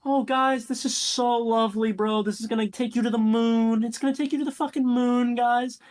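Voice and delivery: oversell the weed like a giddy hustler; high, eager sales pitch to his buyers; hyped-up dealer. Retold or imitated. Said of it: "He's like".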